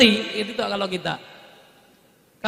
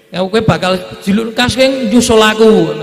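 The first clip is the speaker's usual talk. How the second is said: louder, slowing on the last words; emphatic mock telling; 9 dB louder than usual. Imagined father telling us we follow, emphatic. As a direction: say it straight out to us.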